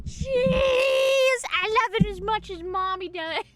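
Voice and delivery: high, loud cheesy dog voice; dog's voice. Pretend. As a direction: shout cheese as the excited pup. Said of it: "she says".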